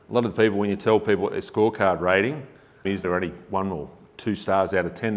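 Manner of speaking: ordinary delivery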